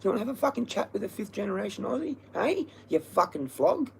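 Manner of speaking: high-pitched taunting rant at half volume; mock yelling. Speaker re-enacts yelling insults in the bush, high mock yell. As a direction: needle them in a high, hushed mock yell. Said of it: "I'm yelling that in the bush".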